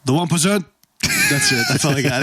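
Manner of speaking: lightly voiced Bernie Sanders saying his line; imitating Bernie Sanders. Cohost asks for quick impression, mock Bernie Sanders voice. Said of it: "hit him real quick with a Bernie Sanders".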